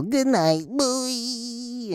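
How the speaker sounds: high, soft, drawn-out falsetto; blissed-out sign-off. Acted. Comic on benzo bedtime, blissed-out falsetto. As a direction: drift off on a long high squeal.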